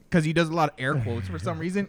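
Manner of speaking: usual speaking voice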